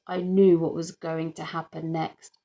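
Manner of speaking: ordinary delivery, nothing marked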